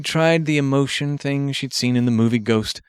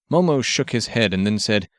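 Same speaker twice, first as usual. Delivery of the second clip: usual speaking voice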